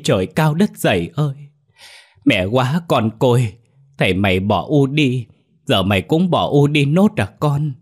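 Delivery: high, loud keening cry for a drowned daughter, slowing at the end; wailing lament. A narrator's reading. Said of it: "Bà gào lên". (gào khóc thảm thiết, cao và to)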